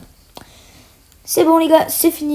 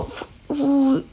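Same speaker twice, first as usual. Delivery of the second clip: ordinary talk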